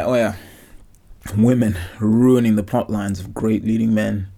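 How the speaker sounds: ordinary talk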